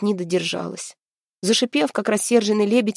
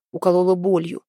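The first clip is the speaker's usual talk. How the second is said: ordinary delivery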